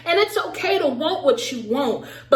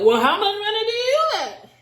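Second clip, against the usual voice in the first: high-pitched, climbing mock outrage; mock indignant retort; 9 semitones higher than usual. Imitating Brett asking Olivia her income, mock indignant. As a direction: imitate someone put out, pitch soaring.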